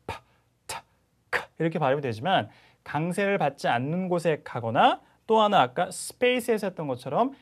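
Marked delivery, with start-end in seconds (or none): 0.1-1.5 파, 타, 카 each with a burst of air; separate, breath-filled 파, 타, 카; aspirated consonants. Shown as a demonstration. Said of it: "강세를 받는 곳에 오면". (파, 타, 카를 바람 소리 강하게)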